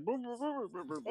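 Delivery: high mumbling through a candy; sucking a hard candy. Pretend. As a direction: mumble around a mouthful of candy. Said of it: "so I'd be like".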